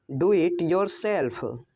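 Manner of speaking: slow, careful "Do it yourself"; slow repeat. Shown as a demonstration. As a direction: say "Do it yourself" slowly for learners. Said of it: "English sentence said twice". (धीमी, साफ़ दोहराई)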